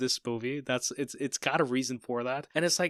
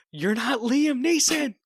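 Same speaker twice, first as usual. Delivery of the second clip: slow, high, loud mock yell of the line; high mock shout; 11 semitones higher, 6 dB louder, slower than usual. Quoting a movie line to a friend, high slow mock shout.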